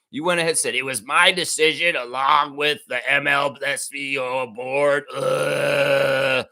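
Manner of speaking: heated, ranting tirade; building raw furious rant. Real, not acted. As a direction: tear into him with real anger. Holds an angry rant.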